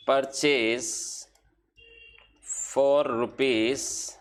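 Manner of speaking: slow, drawn-out words spoken as they are written on the board; slow dictation while writing. Shown as a demonstration. Teacher writing entries on the board, slow dictation. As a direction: dictate each word slowly as you write it.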